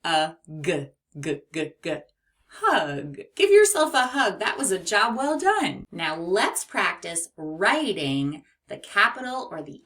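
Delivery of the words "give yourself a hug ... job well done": higher and much louder cheerful call; excited cheer. Real, not acted.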